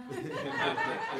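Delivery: a high breathy laugh of disbelief; incredulous laugh. Acted. Gideon laughing at being called mighty. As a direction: laugh like you can't believe it's you. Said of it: "He went".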